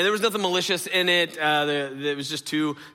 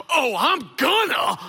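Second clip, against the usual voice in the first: high, hammed-up defiant yell; 9 semitones higher, 4 dB louder than usual. Daughter yells back at dad across court, defiant. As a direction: yell it back like a furious kid. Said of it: "she yells back at me across the court".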